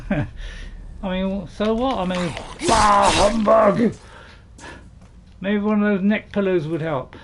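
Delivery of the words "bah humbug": loud, raised humbug outburst; playful loud dismissal of Christmas; mock Scrooge grumble. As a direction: exclaim it like a hammed-up Christmas grouch. Mock Scrooge exclamation about Christmas.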